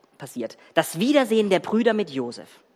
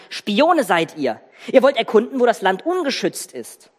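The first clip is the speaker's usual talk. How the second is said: snapping accusation; 4 semitones higher, 4 dB louder than usual. Acted. Joseph as ruler accuses his brothers of spying, angry. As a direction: hurl the accusation at them. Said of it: "und er fuhr sie an".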